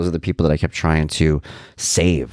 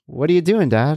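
lightly voiced son, pitched well up; high, mildly baffled retold question; puzzled son; 9 semitones higher than usual. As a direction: question your dad in a higher voice. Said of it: "his son turns to me and goes".

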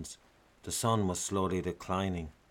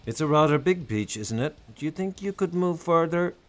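strained politeness; 8 semitones higher, 6 dB louder than usual. A narrator's reading. Husband confronting skinheads, strained politeness.

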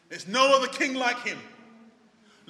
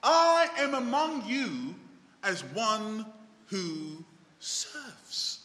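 drawn out very slowly, dropping softer on the last words; slow solemn quote; 3 semitones lower, 5 dB quieter, slower than usual. Retold or imitated. Preacher voicing Jesus to the congregation, slow and solemn. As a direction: let each word of Jesus land slowly. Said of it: "This is Jesus speaking".

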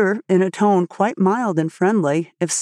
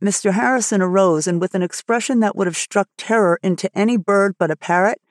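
plain reading voice

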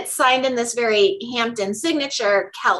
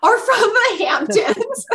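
slow, higher, laughing echo of the joke; 9 semitones higher, slower than usual. Real, not acted. Host repeating her co-host's Hamptons joke, laughing. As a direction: savour the line through real laughter.

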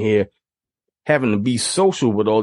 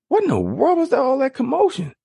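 much higher, startled question; a mother coming out bewildered; startled mom. Retold or imitated. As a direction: mom asking what happened, pitched high. Mom hearing of commotion.

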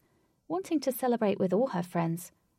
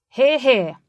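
loud team cheer, much louder; cheering hear hear; 4 semitones higher, 14 dB louder than usual. A narrator's reading. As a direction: shout it out as a team in approval. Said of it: "said the South team players".

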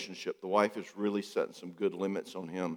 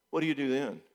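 ordinary delivery

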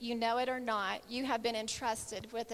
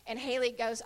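ordinary talk

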